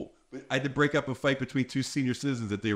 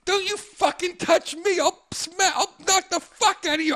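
put-on angry old lady; 3 dB louder than usual. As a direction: snarl the threat high. Old lady threat quoted.

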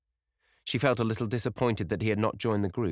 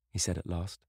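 ordinary delivery

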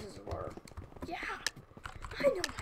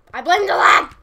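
very loud, higher shouted excuse; mock yell; 9 semitones higher, 23 dB louder than usual. Gamer yelling a playful excuse about lag. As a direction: yell the excuse at full volume.

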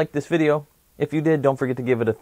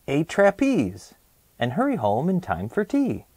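puzzled recalling; 6 semitones higher, much slower than usual. Acted. Vincent tries recalling list.

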